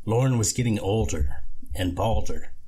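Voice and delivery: plain reading voice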